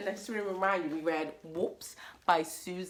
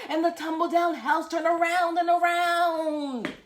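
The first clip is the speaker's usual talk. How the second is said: loud, high, building spell-casting narration; dramatic storytelling; 9 semitones higher, 6 dB louder than usual. A narrator's reading. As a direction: boom it out, drawing out the last word.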